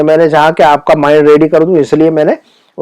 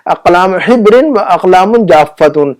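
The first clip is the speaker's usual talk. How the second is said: measured but connected Arabic reading; quicker repeat reading. Shown as a demonstration. Teacher repeating the Arabic phrase after translating, slightly slow and connected. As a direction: read the phrase through without stretching every syllable.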